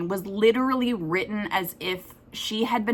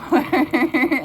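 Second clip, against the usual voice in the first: burst of laughter; 6 semitones higher, 5 dB louder than usual. Real, not acted. Laughs at her long list.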